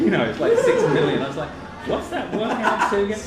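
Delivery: laughing while telling it. Real, not acted. Laughing through the retelling.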